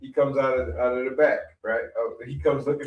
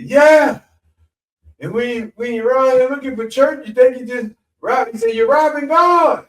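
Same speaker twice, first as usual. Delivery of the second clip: much higher, louder hellfire preacher; preaching shout; 11 semitones higher, 9 dB louder than usual. Retold or imitated. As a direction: thunder it from the pulpit.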